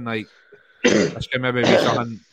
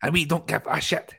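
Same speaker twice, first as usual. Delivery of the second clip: lightly voiced [name], raised pitch; a hint of [name] doing his Georgia skit; [name] impression; 5 semitones higher, 3 dB louder than usual. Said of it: "[name]".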